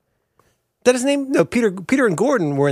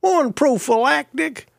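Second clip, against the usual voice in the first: slow, very high country drawl; Slim Pickens; 10 semitones higher, slower than usual. Pretend. Reading out survival pack contents, high rural drawl. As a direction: draw it out high like a cowpoke. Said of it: "in my impersonation of him".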